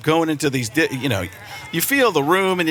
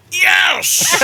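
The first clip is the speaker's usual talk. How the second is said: high, loud joyful yes; a delighted shouted yes; excited yes; 14 semitones higher, 4 dB louder than usual. Retold or imitated. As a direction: shout it out with glee. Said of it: "And I'm like".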